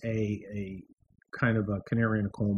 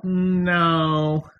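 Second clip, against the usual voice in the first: drawn-out, higher mock "no"; emphatic refusal; 5 semitones higher, 5 dB louder than usual. Voters answering the socialist, drawn-out emphatic no. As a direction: stretch it out as the people's refusal. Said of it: "And the people were like".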